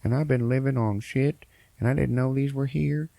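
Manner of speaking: imitating a rambling old coot; old farmer voice. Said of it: "an old guy who's like".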